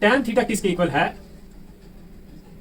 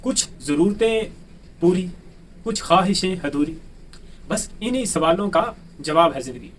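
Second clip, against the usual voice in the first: poem recitation; 4 semitones lower than usual. Acted. Teacher opens his maths class with a recited poem. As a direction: recite it like a couplet.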